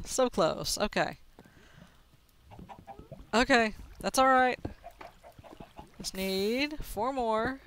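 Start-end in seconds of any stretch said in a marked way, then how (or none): none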